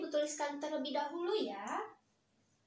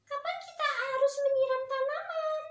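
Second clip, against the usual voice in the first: put-on high, loud child asking her father; small daughter asking her dad, high and bright; little girl voice; 12 semitones higher, 7 dB louder than usual. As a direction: pipe up like an eager little girl.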